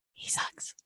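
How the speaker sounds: whispered aside; hushed under the breath; whispering. Real, not acted.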